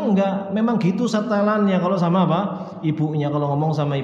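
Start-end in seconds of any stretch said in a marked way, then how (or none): none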